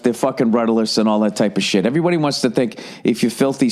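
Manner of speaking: ordinary delivery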